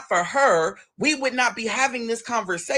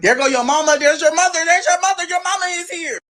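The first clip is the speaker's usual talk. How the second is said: excited aunt; 8 semitones higher than usual. Retold or imitated. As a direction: hype up the nephew like his mom just arrived. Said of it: "Aunt B B was like".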